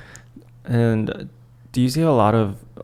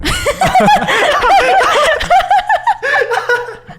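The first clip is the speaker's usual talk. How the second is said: high-pitched laughing fit; 4 dB louder than usual. Real, not acted.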